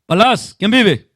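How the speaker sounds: un llamado muy fuerte y lento a Palaz; despiértalo con la voz, alargando cada palabra; llamada fuerte. Real, not acted. Preacher calls out to congregant. (el predicador llama en voz alta a Palaz)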